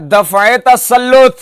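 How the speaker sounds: दफ़े ending in a linking e; correct pronunciation. Shown as a demonstration. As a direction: say दफ़े with -e joined to तसल्लुत. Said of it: "फिर एक लफ्ज चलता है".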